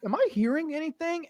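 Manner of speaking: high, mock frightened victim; mock terror. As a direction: play the scared guy, half-joking. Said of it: "He's like".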